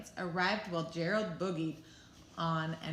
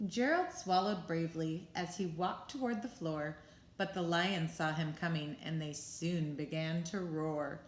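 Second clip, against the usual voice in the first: ordinary delivery, nothing marked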